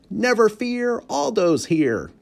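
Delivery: big booming high call; roared. A narrator's reading. Janitor bursting into the dark classroom, booming high. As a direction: burst through the door shouting it.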